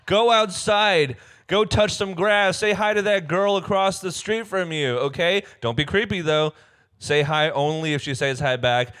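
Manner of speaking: high, loud hammed-up scolding; exasperated play rant, high and forceful; mock rant. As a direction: lecture the listeners at full volume.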